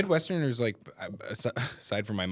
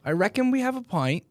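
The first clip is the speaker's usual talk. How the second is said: copying a British lad, higher; match his mock British lad voice; an American echoing a British accent; imitated British accent; 10 semitones higher than usual. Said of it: "I'll match the".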